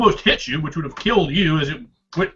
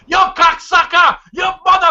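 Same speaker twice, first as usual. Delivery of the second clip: screaming driver; 12 semitones higher, 6 dB louder than usual. Acted. Angry driver screams at players who nearly wrecked him, high loud yell. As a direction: scream the insults at the carjackers. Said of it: "he's just screaming".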